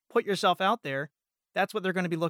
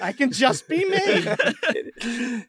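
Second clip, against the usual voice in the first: laughing; 5 semitones higher, 6 dB louder, much slower than usual. Real, not acted. Joking he needn't act.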